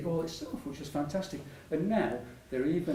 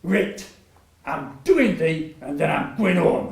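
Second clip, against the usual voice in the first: put-on louder, slow Rotherham accent on the doctor's words; a broad Yorkshire Rotherham doctor, louder and drawn out; 4 semitones higher, 11 dB louder, slower than usual. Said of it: "in a Rotherham accent".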